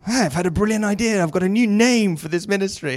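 high, eager mock announcement; excited idea pitch. Church member announcing a new ministry name, excitedly.

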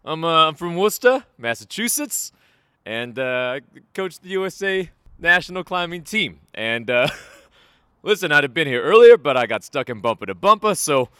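mock Boston accent, high and loud, ribbing a colleague; Boston Josh impression. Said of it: "I'm gonna do the Boston version".

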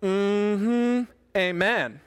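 congregational mm-hmm and amen with some attitude; a little attitude. Shown as a demonstration. Pastor shows listeners their response, a little attitude. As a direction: give a small, sassy amen response. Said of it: "With a little attitude".

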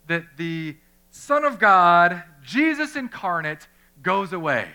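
Son of God pulled out slow and loud; slow, loud disbelief on the Son of God; emphatic question. Real, not acted. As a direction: lean hard on the Son of God. Preacher stresses incredulous question.

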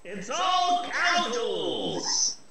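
slow, high, drawn-out exclamation; slow high gleeful catchphrase. Pretend. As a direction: savour every word of the catchphrase. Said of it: "That's my new nothing makes sense catchphrase".

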